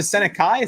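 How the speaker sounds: "Seneca" ending like "kai"; pronunciation guess. Shown as a demonstration. Streamer tries the surname, "Seneca" ending in "kai". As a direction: say "Seneca" as SEN-uh-kai, unsure. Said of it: "I keep forgetting how it's pronounced".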